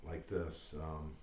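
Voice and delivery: ordinary delivery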